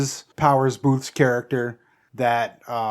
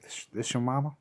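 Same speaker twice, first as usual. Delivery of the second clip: Matthew McConaughey; 12 dB quieter than usual. Acted. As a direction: try a bad impression, softly. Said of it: "that's a bad".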